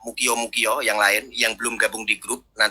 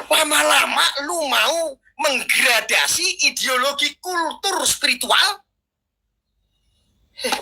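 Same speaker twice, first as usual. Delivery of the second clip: fiery preaching. Acted.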